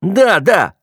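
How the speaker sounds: high-pitched sneering parroting; mock the other person back; exaggerated taunting echo; mocking mimicry. A narrator's reading. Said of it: "передразнил".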